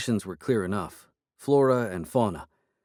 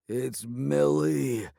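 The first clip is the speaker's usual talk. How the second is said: slow, stuffed-nose voice after the fall; clogged voice; 4 semitones higher, much slower than usual. A narrator's reading. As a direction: drag out Millie through a swollen nose. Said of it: "he said in a clogged voice".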